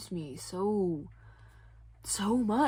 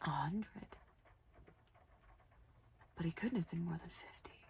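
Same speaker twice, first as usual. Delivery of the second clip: hushed whisper; 8 dB quieter than usual. A narrator's reading. Shock at father's state.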